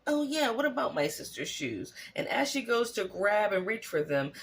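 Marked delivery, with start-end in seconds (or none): 0.1-1.9 higher mock witch catching on; a lightly voiced witch taking the bait; witch imitation. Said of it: "the witch is like".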